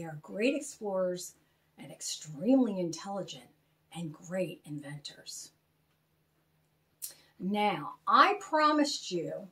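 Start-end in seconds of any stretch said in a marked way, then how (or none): 3.9-5.5 soft unvoiced whisper. Host telling children a secret about Kelvs, whispered. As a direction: let it drop to a secret whisper.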